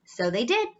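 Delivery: plain reading voice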